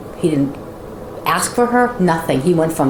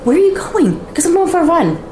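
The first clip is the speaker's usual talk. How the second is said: high incredulous; 12 semitones higher than usual. Retold or imitated. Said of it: "I'm like".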